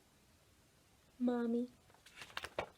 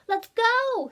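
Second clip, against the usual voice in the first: much higher, louder excited "me, me"; eager boys; 12 semitones higher, 9 dB louder than usual. A narrator's reading. Boys to Ruby, a much higher, louder eager shout. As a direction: jump at the chance.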